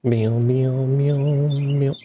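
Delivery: high, playful meows; cat meowing. Pretend. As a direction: meow like a cat. Imitating a cat.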